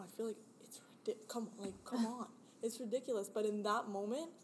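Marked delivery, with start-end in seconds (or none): 0.0-2.3 embarrassed whisper; real embarrassed whisper to friends. Real, not acted. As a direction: drop to a whisper, hurried. Embarrassed aside while confessing.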